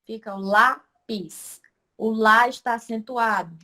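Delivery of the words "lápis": "lápis" broken slowly into two syllables; slow, teacherly syllable split of "lápis". Shown as a demonstration. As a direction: say "lápis" in two pieces, pause between. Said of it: "Quando a gente separa a palavra".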